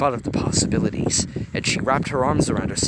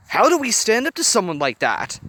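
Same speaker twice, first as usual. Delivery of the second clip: much higher, louder challenge; higher, louder demand; 10 semitones higher, 6 dB louder than usual. A narrator's reading. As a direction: demand an answer. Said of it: "Disgust demanded".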